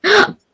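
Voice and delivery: sudden high bright laugh; loud laugh. Real, not acted. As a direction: burst out laughing. Laughing burst mid-chat.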